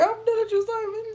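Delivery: high, loud playful brush-off; mock done. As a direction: squeal it like a fake breakup. Mock dismissal of a chat user.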